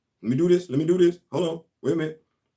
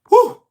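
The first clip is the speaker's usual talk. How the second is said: relieved whew; 10 dB louder than usual. Real, not acted. Relief after finding the link.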